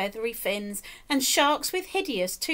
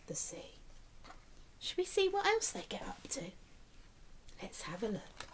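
soft hushed breath, quick; whisper; 2 semitones lower, 12 dB quieter, faster than usual. Real, not acted. Reader whispering to the child to turn the page. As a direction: tease the next page in a hush.

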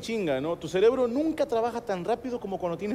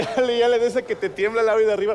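ordinary talk

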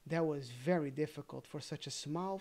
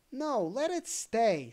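put-on higher, louder, slow boyish plea; slow and louder, stopping his friend; boy pleading; 6 semitones higher, 6 dB louder than usual. Boy asking to spare the daisy, slow loud plea. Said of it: "said the other boy".

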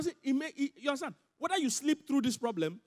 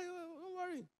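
soft reassurance; 9 semitones higher, 9 dB quieter, much slower than usual. Real, not acted. Soothing the congregation.

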